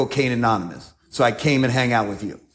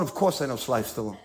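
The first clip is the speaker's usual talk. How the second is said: much softer, offhand boast with a laugh; cool understatement; 3 semitones lower, 8 dB quieter than usual. Acted. Bragging to bar locals, smug soft laughing boast. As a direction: shrug it off, quiet and smug.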